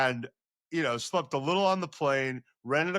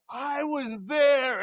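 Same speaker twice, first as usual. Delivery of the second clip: excited; 12 semitones higher, much slower than usual. Retold or imitated. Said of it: "and I'm like".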